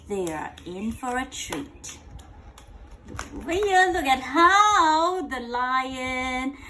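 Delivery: usual speaking voice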